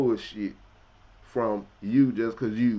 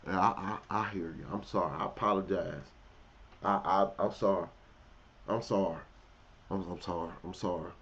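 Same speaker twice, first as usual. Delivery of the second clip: meek apology; 7 semitones lower, 6 dB quieter than usual. Retold or imitated. Boss voiced apologizing meekly.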